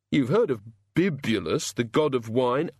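a dazed, hungover god, slow and halting; lightly voiced sufferer, fumbling for words; groggy hungover god. A narrator's reading.

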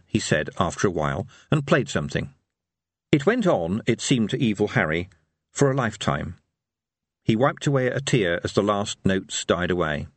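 ordinary delivery, nothing marked

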